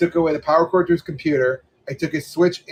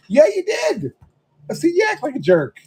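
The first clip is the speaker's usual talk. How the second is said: high-pitched exasperated rebuke; mock-indignant, high-pitched telling-off; retold scolding; 13 semitones higher than usual. Retelling scolding of his son.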